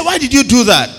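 high, loud demand for a reason; exasperated policeman. Retold or imitated. Policeman to driver, high loud exasperated why. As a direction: cop throwing up his hands at the driver.

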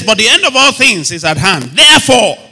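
loud, high proclamation; declaimed scripture. Real, not acted. Preacher reads Peter's verse to congregation, declaimed scripture. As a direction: build to a ringing final word.

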